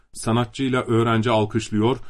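ordinary delivery

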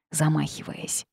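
plain reading voice

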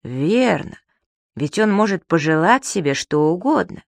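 plain reading voice

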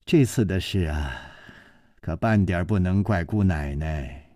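low, softened appeasing voice; ingratiating smile. A narrator's reading. Old man excusing the young lady to her father, ingratiating. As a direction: placate gently with an apologetic smile. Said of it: "陪着笑说".

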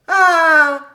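loud, high cry of wonder; a big admiring "ah"; amazed admiration. A narrator's reading. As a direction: exclaim in wide-eyed delight. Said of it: "les yeux écarquillés d'admiration".

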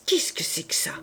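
whispered under the breath; between his teeth. A narrator's reading. Jean Valjean startled at seeing the coin, whispered through his teeth. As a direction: hiss it through clenched teeth. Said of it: "dit-il entre ses dents".

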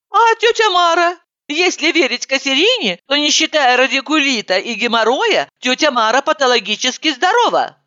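high, sceptical objection; high girlish voice. Acted. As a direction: raise the voice to object.

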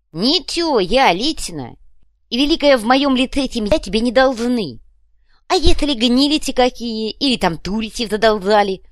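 high, loud lisping character voice; blustering fuhrer. A narrator's reading. Fuhrer disowns family debts, blustering. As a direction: bluster with muddled, lisped consonants.

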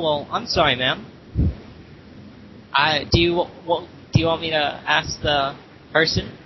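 ordinary delivery

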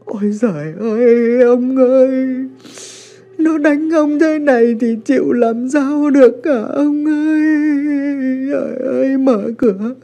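high, loud, frantic pleading; a mother desperate to open the door; panicked wailing. A narrator's reading. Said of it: "luống cuống". (gào khóc hoảng loạn)

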